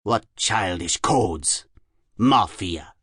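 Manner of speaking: put-on slow, measured German-accented recital; scornful German-accented professor; sneering Germanic accent. A narrator's reading. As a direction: intone each phrase with a German accent, pausing between. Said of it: "he sneered".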